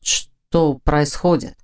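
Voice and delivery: drowsy man, dragging the words; half asleep. A narrator's reading. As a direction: ask groggily, still not fully awake. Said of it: "всё ещё не до конца проснувшись".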